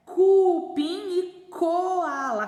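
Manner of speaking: "Cupim" and "coala" slow, high and loud; slow cuuu-pim, cooo-ala; first syllables stretched. Shown as a demonstration. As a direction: hold "cu" and "co" for comparison. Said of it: "Qual começa com co?".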